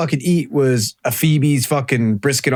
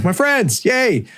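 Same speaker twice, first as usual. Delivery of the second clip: higher, mock-happy "yay" at seeing friends; mock cheer; 7 semitones higher, slower than usual. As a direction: cheer with half-joking relief. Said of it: "I'm like".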